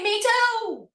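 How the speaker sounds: high, eager me too; excited agreement. Real, not acted. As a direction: jump in with delight.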